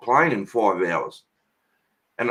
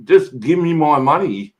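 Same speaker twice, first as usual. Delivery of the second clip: retold rant; 4 semitones higher than usual. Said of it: "And I said".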